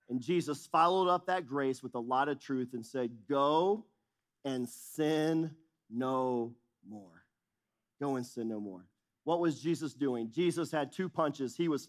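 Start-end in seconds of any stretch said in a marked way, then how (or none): 3.3-7.2 very slow, weighty command; solemn and slow. Retold or imitated. Jesus sending the woman away, slow and solemn. 8.0-8.9 quieter, lower repeat; softer echo. Retold or imitated. Jesus repeating his command, hushed and low.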